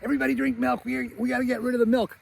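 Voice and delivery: high-pitched imitation of a harried mom; Kate. Mom tells the kids to finish milk, high imitation. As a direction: push the voice way up, mothering a crowd. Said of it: "the mom, is like".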